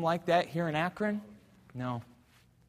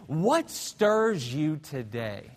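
drawn-out, deliberate question; slow question; much slower than usual. Acted. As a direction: let each word land slowly. Preacher's slow question to congregation.

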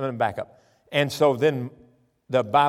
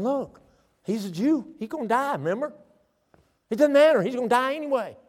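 high, matter-of-fact warning; a hint of wife and friends warning him; lightly voiced friends; 10 semitones higher than usual. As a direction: tell him the bad news bluntly. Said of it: "they're saying".

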